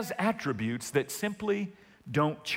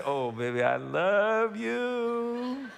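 tender to wife; 3 semitones higher, much slower than usual. Retold or imitated. Pastor playing husband to wife over Chinese food, slow and tender. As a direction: melt it out slowly for your wife. Telling his wife he loves her.

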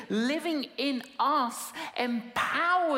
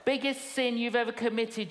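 normal talking voice

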